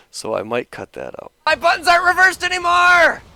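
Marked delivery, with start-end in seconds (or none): 1.4-3.2 very high, loud cartoon cheer; excited squeaky shout. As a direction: yell it like an eager tiny hero. Replayed excited character shout.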